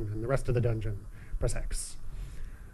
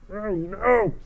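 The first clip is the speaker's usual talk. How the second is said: surprised; 8 semitones higher, 4 dB louder than usual. Real, not acted. Sudden danger in the game.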